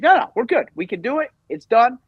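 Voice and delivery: imitating an overconfident friend; eager reassurance. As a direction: wave off every doubt, voice up high. Retold friend brushing off worries.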